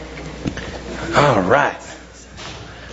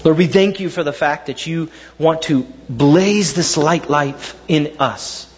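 prayer; 4 semitones lower than usual. Real, not acted. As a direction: pray humbly over the congregation. Pastor leads prayer.

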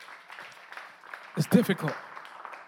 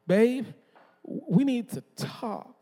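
wife's ominous voice; 3 semitones higher, much slower than usual. Retold or imitated. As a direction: drag out the dreaded sentence. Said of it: "She's from North Carolina".

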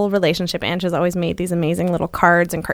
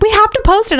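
insistent partner; 14 semitones higher, 4 dB louder than usual. Retold or imitated. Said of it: "Ange was like".

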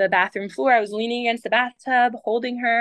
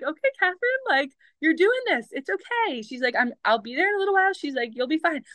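reassuring midwife; 7 semitones higher, faster than usual. Retold or imitated. Midwife reassuring her on phone.

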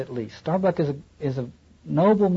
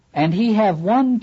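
put-on higher, halting pidgin accent; broken pidgin English; 7 semitones higher, 4 dB louder than usual. Lecturer voicing Queequeg to the captain in pidgin English. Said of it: "doesn't speak very good English".

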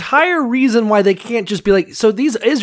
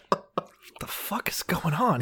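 whisper; 12 dB quieter, slower than usual. Acted. Victim finds knife under chair.

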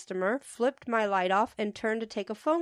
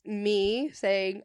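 ordinary delivery

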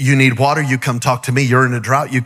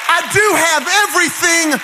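raised, shouting preacher's climax; full-force preaching; 8 semitones higher than usual. Acted. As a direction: build the room up and shout it out.

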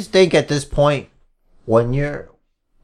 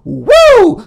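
loud high wrestler's woo; Ric Flair woo; 6 dB louder than usual. Acted.

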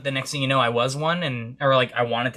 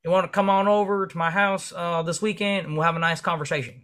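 lightly voiced older man, higher and easygoing; a hint of friendly old-school guy; 6 semitones higher than usual. Range owner inviting the young marine over, easy friendly voice.

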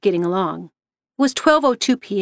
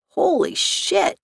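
slow, higher-pitched astonishment; disbelief; 5 semitones higher, slower than usual. A narrator's reading. Kim watching the shades move by themselves, in disbelief. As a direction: draw it out, stunned. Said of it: "Kim said in disbelief".

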